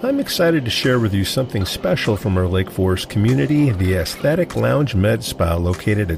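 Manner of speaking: smooth sponsor read. Acted. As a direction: sell the spa like a radio spot. Sponsor ad read over music.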